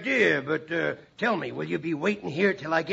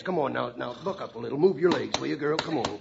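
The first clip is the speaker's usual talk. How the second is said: coaxing a horse; 8 semitones lower, 6 dB quieter than usual. Acted.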